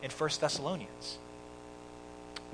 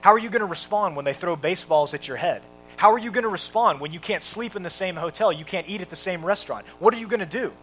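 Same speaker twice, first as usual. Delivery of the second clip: loud, raised, insistent mock yell; retold screaming; 4 semitones higher, 7 dB louder than usual. Dodgers owner testing Jackie Robinson in an interview, retold screaming. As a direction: imitate a boss hammering questions at someone. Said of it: "he starts screaming at him".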